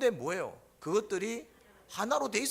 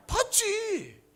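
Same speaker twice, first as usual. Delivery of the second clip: high, loud burst of certainty; emphatic yes; 13 semitones higher, 6 dB louder, much slower than usual. Real, not acted. Preacher answering his own question, emphatic high. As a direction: shout the obvious answer up high.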